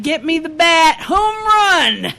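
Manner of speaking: high, rousing call to swing; mock battle cry. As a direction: call out like a batter, hamming it up. Acts out reaction to marching squirrel.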